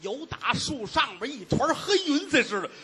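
normal talking voice

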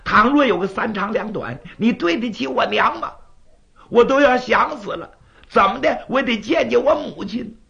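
put-on high, loud, pleading boy's voice; a boy crying out at his father, near tears; distressed young son. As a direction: son demanding answers about his mother. Son asks where his mother is.

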